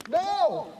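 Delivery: high shocked cry of no; shocked shout. Real, not acted.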